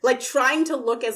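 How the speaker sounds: ordinary talk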